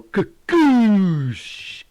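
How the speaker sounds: loud, high mouth-made boom; imitated massive explosion; big explosion noise. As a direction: make the blast huge with your mouth.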